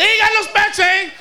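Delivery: Ain't got no spare change, thrown back high and loud; mock the line back loudly; loud mocking echo. Attendant mocks driver's excuse, loud and high. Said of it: "He said".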